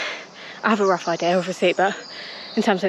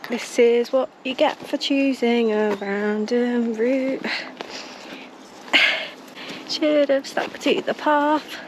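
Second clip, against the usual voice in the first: moaning; 3 semitones higher, slower than usual. Real, not acted. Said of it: "I'll stop moaning now".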